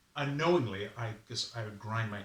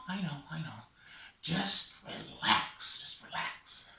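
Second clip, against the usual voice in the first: soothing; 4 semitones higher than usual. Acted. Raven soothes the sufferer.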